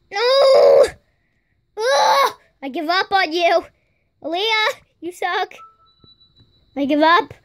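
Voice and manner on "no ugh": a high frustrated yell at the dog; frustrated shout. Real, not acted. Dog fails the trick.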